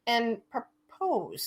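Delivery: "and propose" drawn out very slowly. Shown as a demonstration. Setting up the prank, saying propose very slowly. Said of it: "I gotta say that slowly".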